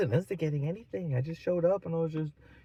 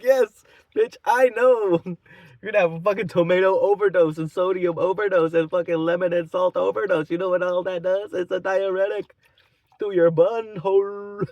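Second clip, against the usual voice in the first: loud, raised retort, relished to the end; mocking tirade; 4 semitones higher, 7 dB louder than usual. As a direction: tell her off with loud comic scorn. Acted tirade at the drunk woman.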